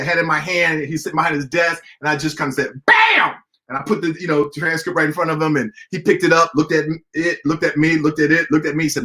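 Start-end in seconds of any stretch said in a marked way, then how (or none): 2.8-3.4 high, loud mock slam; playful bam. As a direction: slap it down with a triumphant bam. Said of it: "I was being funny".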